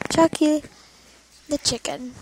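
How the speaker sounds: Chucky shouted loud; loud reveal. Acted. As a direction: shout the name out proudly. Dramatic reveal of the name.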